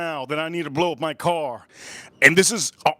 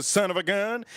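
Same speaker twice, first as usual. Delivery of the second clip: ordinary delivery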